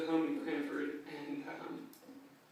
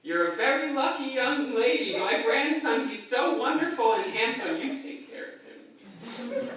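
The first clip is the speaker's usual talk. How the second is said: proud grandma; 7 semitones higher, 8 dB louder than usual. Retold or imitated. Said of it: "turns to Julia and says".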